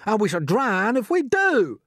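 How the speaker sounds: loud, high, slow retort; crying out. A narrator's reading. Earthworm answers centipede's warning, crying out. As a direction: cry doom back at him. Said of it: "cried the earthworm".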